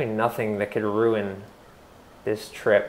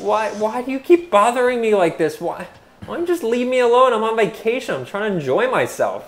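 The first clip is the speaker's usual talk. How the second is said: desperate; 9 semitones higher, 4 dB louder than usual. Acted. Pleading with the demon.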